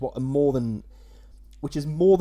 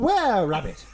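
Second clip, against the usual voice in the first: higher, louder mock-dramatic echo; hammed-up title echo; 8 semitones higher, 6 dB louder than usual. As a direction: belt out the title in mock horror. Co-host echoes the dramatic title.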